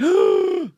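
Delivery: loud high gasp of horror; shocked gasp. Real, not acted. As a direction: gasp as if watching something go wrong. Shocked at botched piercing.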